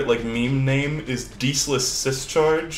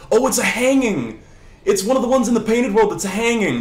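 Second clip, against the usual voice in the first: high, loud, laughing excitement; real gleeful outburst; delighted discovery; 9 semitones higher, 5 dB louder than usual. Real, not acted. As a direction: exclaim it as if thrilled by a funny find.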